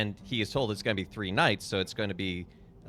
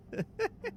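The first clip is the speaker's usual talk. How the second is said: high squeaky giggle; 4 dB quieter than usual. Real, not acted. Laugh after silly voice.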